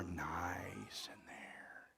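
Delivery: slow whispered aside; slow hushed aside. Real, not acted. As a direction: breathe it out slowly, voiceless.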